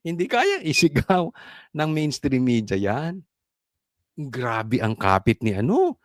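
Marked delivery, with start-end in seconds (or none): none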